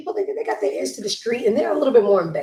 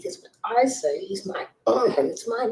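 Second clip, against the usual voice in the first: usual speaking voice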